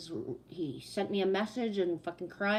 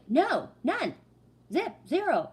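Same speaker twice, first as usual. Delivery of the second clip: loud, high, punched one-word denials; retold adamant no; emphatic refusal; 7 semitones higher, 5 dB louder, slower than usual. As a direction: shut him down word by word. Retold flat refusal to boss.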